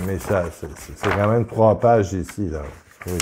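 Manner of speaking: ordinary talk, nothing marked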